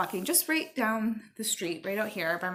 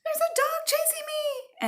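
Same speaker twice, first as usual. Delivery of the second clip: put-on high, frantic kid's cry for help; much higher, panicked yelp. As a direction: squeal it out like a scared kid fleeing a dog. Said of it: "And I went".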